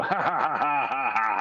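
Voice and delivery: a chorus of spelled-out ha ha laughter; ship crew laughing. Acted. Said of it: "the ship at this point will pipe up and go".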